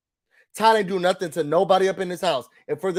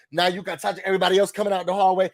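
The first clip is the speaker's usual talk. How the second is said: ordinary talk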